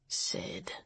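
barely whispered name; hushed, tender. A narrator's reading. Rob at Sydney's hospital bedside, hushed. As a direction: breathe the name in a soft whisper. Gentle bedside words to broken friend.

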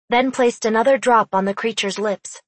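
ordinary delivery, nothing marked

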